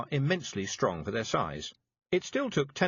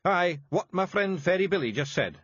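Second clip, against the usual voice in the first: high, thick Scots pixie voice; small tough Scots fairy breaking bad news; Scottish Feegle voice; 8 semitones higher, 5 dB louder than usual. A narrator's reading. Feegle Rob.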